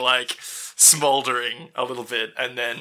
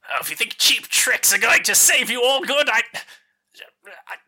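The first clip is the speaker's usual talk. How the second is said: high, sneering official voice; scoffing envoy; 8 semitones higher than usual. Acted. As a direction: brush them off with a sneer.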